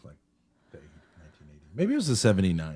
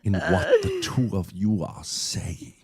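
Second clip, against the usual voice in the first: put-on German accent; 10 semitones higher than usual. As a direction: ponder each word like Herzog. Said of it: "Like Werner Herzog or something".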